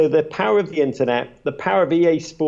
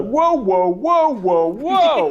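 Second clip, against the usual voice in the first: mock Brokaw cutting in; 9 semitones higher, much slower than usual. Imitating Brokaw interrupting a guest mid-sentence, hammed-up and high. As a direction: wave him down in a hammed-up Brokaw voice. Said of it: "Tom Brokaw cut me off".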